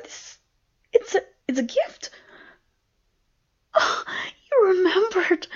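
gasping, high and loud delight; thrilled, voice leaping up; overjoyed surprise. Acted. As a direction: burst out in breathless astonishment at a gift. Discovers a surprise gift.

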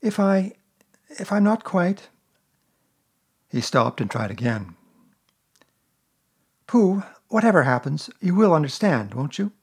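put-on a small boy catching on; boyish Christopher Robin. A narrator's reading. Christopher Robin voiced higher.

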